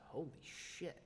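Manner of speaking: retold stunned mutter under the breath; very soft, awed aside; hushed awe. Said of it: "I was like".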